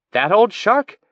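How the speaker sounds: can't believe what she just heard; incredulous. A narrator's reading. Hearing Mateo spoke with the pirate, incredulous. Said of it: "Tissigny said incredulously".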